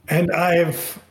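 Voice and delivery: usual speaking voice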